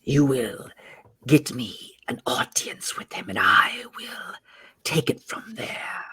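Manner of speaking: soft, breath-heavy whispered order; commanding serpent creature. Acted. Same serpent character gives orders.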